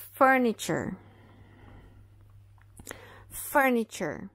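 furniture with ch sound; fur-ni-cher, t as ch; furniture, slow English model; English model word. Shown as a demonstration. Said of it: "esse T vai ser pronunciado como C H".

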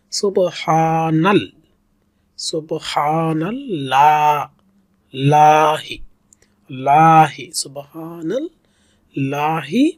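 সোবহানাল্লাহি read slowly with হা stretched; careful tajweed phrase; slow recitation. Shown as a demonstration. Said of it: "এক আলিফ টানতে হবে".

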